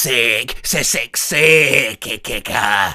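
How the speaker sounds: lightly South African sick sick; a hint of South African accent on a slow build. Shown as a demonstration. Told to use accent and crescendo, lightly South African. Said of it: "by accent they mean South Africa".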